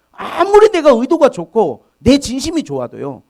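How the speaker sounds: preacher's emphatic climb; impassioned preaching. Acted. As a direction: drive the point home to the congregation. Preaching peak.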